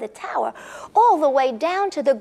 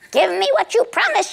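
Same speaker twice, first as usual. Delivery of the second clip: witch demand; 7 semitones higher, 3 dB louder than usual. Acted. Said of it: "she said".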